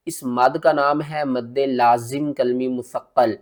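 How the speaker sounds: ordinary delivery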